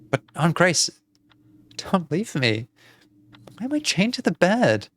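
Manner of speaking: plaintive, rising to an alarmed question; pleading girl. Acted. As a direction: beg your aunt to stay.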